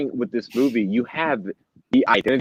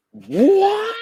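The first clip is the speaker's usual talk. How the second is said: sharp rising squeak of disbelief, much higher; incredulous what; 4 dB louder than usual. Retold or imitated. Hearing a viewer call Pattinson emo, incredulous. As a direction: react like you can't believe your ears. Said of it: "I'm like".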